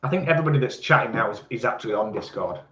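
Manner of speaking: ordinary delivery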